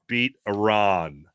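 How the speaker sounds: "Iran" drawn out as ih-RAHN; slow, deliberate "Iran" with long RAHN; pointed pronunciation. Pretend. Host cheering U S A, pointedly saying Iran as ih-RAHN.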